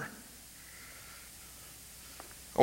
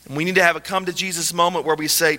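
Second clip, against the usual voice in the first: usual speaking voice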